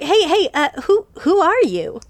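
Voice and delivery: a nervy little fish asking; curious little fish. Acted. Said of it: "One of them's like".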